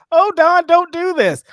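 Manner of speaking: hammed-up high whiny plea; mock innocent Jericho. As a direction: ham up a phony protest to Don. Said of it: "now he's over here like".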